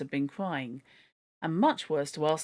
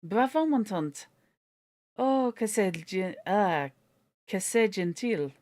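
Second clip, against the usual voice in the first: put-on excited French boy; 3 semitones higher, slower than usual. A narrator's reading. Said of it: "suddenly shouted".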